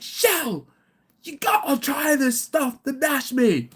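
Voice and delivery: high, loud, slow excited call; excited Chris. Retold or imitated. Pub owner calling to his wife, high and thrilled. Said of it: "He's like".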